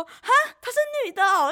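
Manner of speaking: high, loud mock surprise with a laugh; surprised. Opponent realising she is a girl, high mock surprise. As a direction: act out his startled disbelief. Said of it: "偏惊讶".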